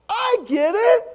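high, loud exclamation; exaggerated realization. Pastor finally getting the kids' point, shouting. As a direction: shout it as it finally dawns on you.

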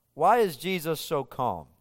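ordinary talk, nothing marked

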